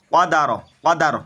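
"কদা র" said quickly, final syllable short; quick recitation. Shown as a demonstration. Teacher showing learners the quick reading, short "কদা র". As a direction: run "কদা র" together without stretching the end. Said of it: "তাড়াতাড়ি".

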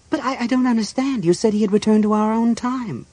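high, bewildered woman's voice; pitched well up, not understanding; confused priestess. Acted. Chrysania is confused by the news.

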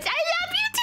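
high, excited squeal; gushing squeal. Real, not acted. Streamer answering a viewer's love, high gushing squeal. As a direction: squeal it with affection, rising at the end.

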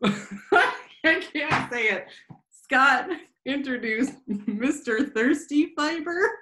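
breaking into giggles mid-sentence; laughing. Real, not acted. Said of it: "keep a straight face when I said Mister Thirsty Fiber".

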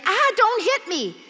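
high, loud yelp of alarm; a dwarf yelping at a touch he mistakes for a blow; startled cry. Acted. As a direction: flinch away as if struck.

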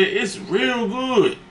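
high, slow forced compliment; mock sweet lie about bad food; fake polite praise. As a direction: hide the disgust behind a high, slow "real good". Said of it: "I said".

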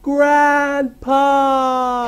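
high, long-drawn wail; anguished cry. Acted. Yugi cries for his grandpa, high anguished wail. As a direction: call out in hammy anguish.

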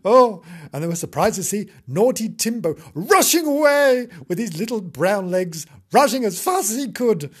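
usual speaking voice

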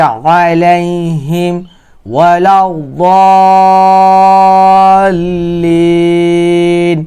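slow, drawn-out verse recitation; Quran recitation. Shown as a demonstration. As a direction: recite slowly, stretching the long vowels and the final syllable.

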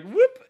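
high, loud "whoop"; quick imitated whoop. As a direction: toss out a high whoop sound effect. Imitated noise as gun vanishes.

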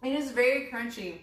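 speaking with mouth partly full; talking between bites; chewing cereal. Real, not acted. Seen 0: Woman lifts a spoon of cereal to her mouth early; the speaker is unclear.

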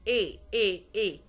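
إي like English letter A, "ay"; loud, clean é on every إي; closed E. Shown as a demonstration. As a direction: say each إي loud and separate as French é. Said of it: "وينطق مثل الإي في الإنجليش".

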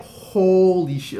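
high, loud, drawn-out amazement; reliving it with a slow, stunned exclamation; freaking out. Retold or imitated. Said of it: "I'm freaking the hell out".

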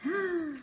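a playful gasp of surprise; surprised gasp. Acted. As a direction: gasp for the children. Mock surprise during story.

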